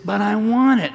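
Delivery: put-on a toddler refusing no, pitched high and loud; whining toddler. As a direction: wail it like a kid on the edge of tears. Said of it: "and started to cry harder".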